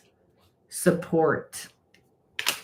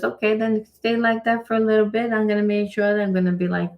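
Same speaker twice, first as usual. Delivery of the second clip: voicing God; 9 semitones higher, faster than usual. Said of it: "And then God says".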